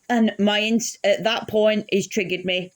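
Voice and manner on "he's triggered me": normal talking voice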